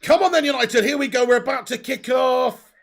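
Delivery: loud, high rallying call to the team; excited hype. Real, not acted. Fan rallying viewers before kickoff, excited call. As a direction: pump up the crowd before kickoff.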